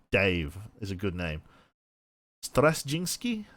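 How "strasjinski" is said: put-on slow, raised Russian-accented question; Russian accent, pitched up like a query. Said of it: "say that in a Russian accent".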